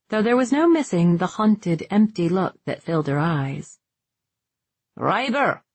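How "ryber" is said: mildly barked name, pitched up then falling; understated bark. A narrator's reading. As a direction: call the name like a curt order. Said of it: "Merrick barked".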